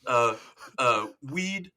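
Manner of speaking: stall, then list items one by one; imitated show character. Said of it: "And he's like".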